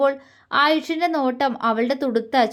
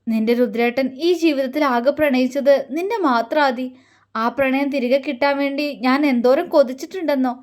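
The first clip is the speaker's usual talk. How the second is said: ordinary delivery, nothing marked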